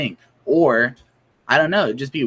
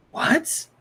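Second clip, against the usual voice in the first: very high incredulous yelp; shocked what. Pretend. Listener reacts to the hypnosis story, shocked. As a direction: squeak out your disbelief.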